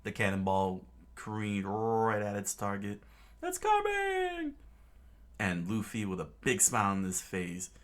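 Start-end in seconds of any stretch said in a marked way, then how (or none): 3.4-4.5 very high, slow panicked cry; high, drawn-out cry of alarm. Acted. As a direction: wail it as the cannonball comes. Crew sees cannonball coming.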